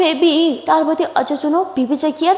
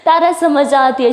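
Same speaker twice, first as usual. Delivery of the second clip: usual speaking voice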